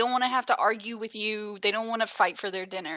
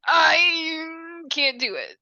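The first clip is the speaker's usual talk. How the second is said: drawn-out, higher mock whine; mock despair; 5 semitones higher, much slower than usual. Fan giving up on a slog of a season, mock-despairing. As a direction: groan it out as if giving up.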